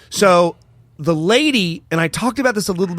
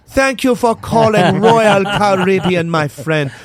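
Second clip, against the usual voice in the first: put-on slow, higher Caribbean island accent; 4 semitones higher, 6 dB louder than usual. Cruise agent answering the phone in an island accent. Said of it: "this fucking island accent".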